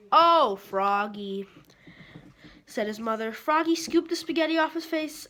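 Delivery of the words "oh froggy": slow, louder exasperated oh; dismayed sigh. Acted. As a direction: drag it out in dismay. Mother dismayed.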